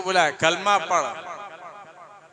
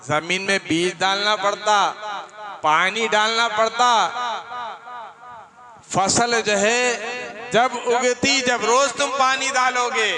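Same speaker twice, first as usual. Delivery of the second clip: higher, louder sermon voice driving the point home; forceful preaching; 7 semitones higher, 6 dB louder, slower than usual. Acted. Preacher voicing the saint's answer to the mother, in loud, high preaching. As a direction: proclaim it to the crowd.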